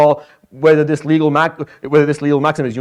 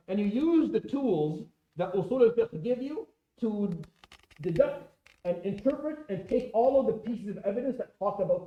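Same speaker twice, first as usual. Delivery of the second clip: distant and much softer, microphone slipped away; off-mic; 2 semitones higher, 14 dB quieter than usual. Real, not acted. Lecturer explaining to students after the mic falls, faint and off-mic. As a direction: talk as if far from the mic. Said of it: "did this fall down".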